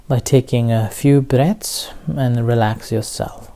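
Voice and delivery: measured meditation teacher with long pauses; unhurried instructions, leaving room to breathe; guided meditation. Real, not acted. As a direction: guide listeners into relaxation, phrase by phrase.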